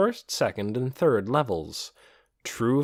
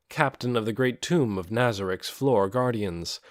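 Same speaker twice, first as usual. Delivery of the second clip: plain reading voice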